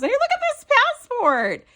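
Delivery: high, loud cooing over the charm; delighted squeal. Real, not acted. Showing viewers the passport charm, a high delighted coo.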